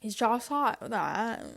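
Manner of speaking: imitating a disliked English accent; mocking her accent. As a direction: drag it out like the accent grates. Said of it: "Every time she speaks".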